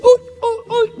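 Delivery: high, squeaky fake alarm; hammed-up surprised yelp; mock surprise. Shown as a demonstration. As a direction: yelp uy uy as if caught off guard. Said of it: "Mi gesto".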